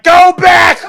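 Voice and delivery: high, loud shout of command; hammed-up attendant shouting; imitated yelling. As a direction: yell it as a frantic flight attendant. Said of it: "she's clearly yelling".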